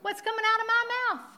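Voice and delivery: high, fast rhetorical jab; excited question. Acted. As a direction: fire the question off high and quick. Preacher's animated rhetorical question.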